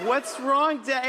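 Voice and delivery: high-pitched imitation of a kid; child's voice. Kid asking dad in the car, imitated child's voice. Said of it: "My kids".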